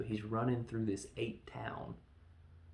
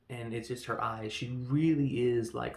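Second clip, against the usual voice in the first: usual speaking voice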